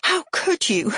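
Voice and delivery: high-pitched outraged accusation; rising voice. A narrator's reading. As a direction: let the voice leap up in reproach. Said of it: "My voice rises".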